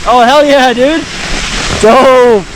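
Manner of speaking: excited. Real, not acted. Excited at the chute.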